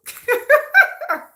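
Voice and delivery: high, loud burst of laughter; high giggle. Real, not acted. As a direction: laugh at yourself. After singing.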